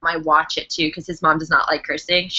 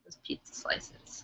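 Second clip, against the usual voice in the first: whisper; 20 dB quieter, slower than usual. Pretend. Whispered aside about food.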